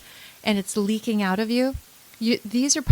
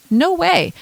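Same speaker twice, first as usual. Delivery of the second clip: higher and louder, firm burst; emphatic no way; 8 semitones higher, 6 dB louder than usual. Real, not acted. Counsellor telling viewers anger won't change things, emphatic. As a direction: shut the idea down hard.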